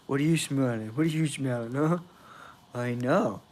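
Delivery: low, quiet aside to a dog; hushed owner-to-dog chat; soft pet talk. Real, not acted. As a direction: talk softly to your pet, off to the side. Murmuring to the dog.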